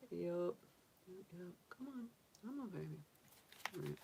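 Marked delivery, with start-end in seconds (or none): none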